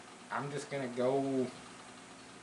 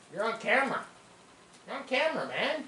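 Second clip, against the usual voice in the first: teasing dog talk; 8 semitones higher, faster than usual. Real, not acted.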